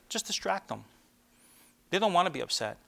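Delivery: normal talking voice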